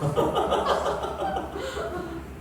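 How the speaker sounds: a high amused laugh. Real, not acted. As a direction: laugh along with the crowd. Laughing at own joke. (높은 웃음소리)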